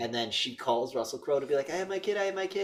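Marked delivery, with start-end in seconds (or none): 1.7-2.6 urgent mother. Retold or imitated. Mother calling the killer.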